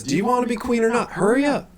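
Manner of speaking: high, loud, rapid challenge; loud, fast, high-pitched pressuring; urgent demand. Acted. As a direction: push impatiently for an answer now. Halia presses Zalora after the murder.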